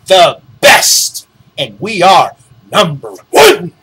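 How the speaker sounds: the best and number one said loud and pressed; mocking emphasis. Shown as a demonstration. Mimicking the company's boast to viewers, loud mock emphasis. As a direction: hammer the boasts home, mockingly. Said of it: "they want to emphasize that we are".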